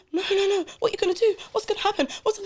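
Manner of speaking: high, worried pleading sister; anxious sister. Retold or imitated. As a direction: voice her panic, pitched high. Sister voiced, panicking.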